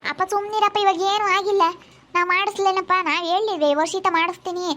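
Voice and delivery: insistent, high-pitched protest; exasperated reassurance. Real, not acted. Hushing an agitated father.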